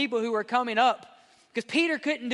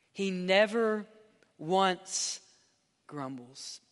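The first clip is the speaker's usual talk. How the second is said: usual speaking voice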